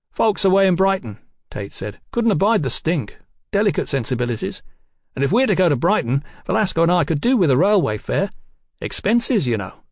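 cheeky rogue detective. Acted. Voices Tate angling for money.

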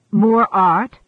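puzzled query with extra volume. A narrator's reading. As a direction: ask it in puzzlement, raising your voice. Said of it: "Dushan puzzled".

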